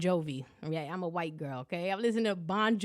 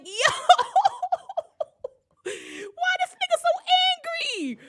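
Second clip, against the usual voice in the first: much higher, laughing through the words; cracking up in disbelief; 7 dB louder than usual. Real, not acted. Laughing at the angry guy on the train, much higher. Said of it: "I'm like".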